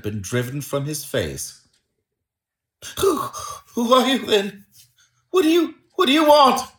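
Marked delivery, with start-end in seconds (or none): 3.0-6.7 high, frightened, faltering questions; quavering voice. A narrator's reading. Little man startled by a touch on his shoulder, quavering. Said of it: "he said in a quavering voice".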